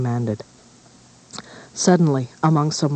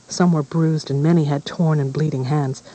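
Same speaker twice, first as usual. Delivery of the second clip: ordinary delivery, nothing marked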